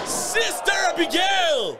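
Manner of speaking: slow, high drawn-out move call; excited call. Real, not acted. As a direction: stretch out the move name. Calling signature move.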